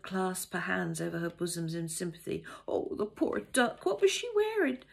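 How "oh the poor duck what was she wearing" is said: gossiping cook. Pretend. Cook pitying the newcomer to Dora, high and loud. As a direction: coo over the poor duck.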